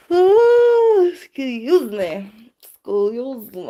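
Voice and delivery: slow yawn through the words. Real, not acted. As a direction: yawn while you say it. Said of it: "excused for yawning".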